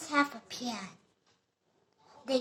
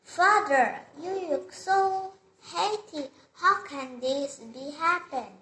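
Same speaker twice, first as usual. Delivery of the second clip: louder, raised voice full of wonder; prince amazed; 4 semitones higher, 6 dB louder than usual. Acted. Prince greets his father, loud and amazed. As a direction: call out to father in happy surprise. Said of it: "And the prince said like this".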